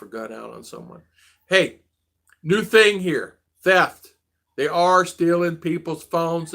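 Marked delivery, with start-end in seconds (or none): 1.5-6.5 urgent warning. Real, not acted. Warning viewers about rising theft.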